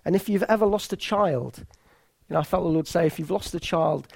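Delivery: normal talking voice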